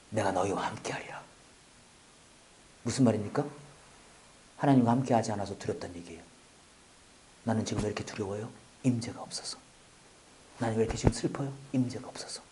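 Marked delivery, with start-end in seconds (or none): 0.1-1.2 hushed whisper of the promise; soft whispered scripture; whispered verse. Acted. Quoting God's promise, hushed. 11.7-12.4 hushed whisper; whispered reply; whispered answer. Acted. Answering own question in a hush.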